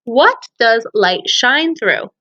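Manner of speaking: ordinary talk, nothing marked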